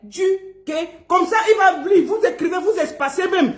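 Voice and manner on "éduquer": "éduquer" split into three syllables; spaced-out "éduquer"; syllable dictation. Shown as a demonstration. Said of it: "Écrivez tous".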